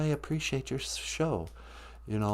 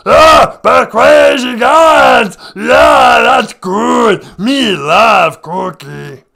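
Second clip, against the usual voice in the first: much higher shouted slow monster growl; Cookie Monster; 11 semitones higher, 15 dB louder, much slower than usual. Acted. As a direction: bellow for cookies like a ravenous monster. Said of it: "stop with the act".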